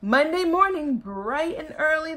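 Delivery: ordinary delivery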